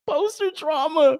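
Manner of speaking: very high, slow, squeezed by laughter; laughing. Real, not acted. Joking about poster trauma, laughing. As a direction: wheeze it out mid-laugh. Laughing at his own story.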